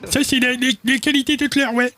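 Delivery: very high falsetto character voice; Tutler. Acted. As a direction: squeak it out far above your own pitch. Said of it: "c'est la voix de monsieur Tutler". (voix de personnage suraiguë)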